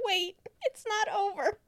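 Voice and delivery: high, laughing protest. Real, not acted. Pleading with the cohost that the movie isn't over, laughing high. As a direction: blurt it out through laughter.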